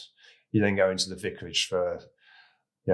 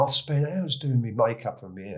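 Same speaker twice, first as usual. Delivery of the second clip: normal talking voice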